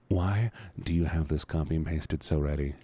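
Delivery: low, measured, resigned question; deflated question. Real, not acted. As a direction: ask it low, pausing after why. Repeats question, lower and slower.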